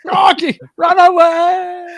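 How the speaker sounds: high, drawn-out mock shout; calling retreat. Quoting knights fleeing the castle, high mock shout. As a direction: holler the retreat in mock panic.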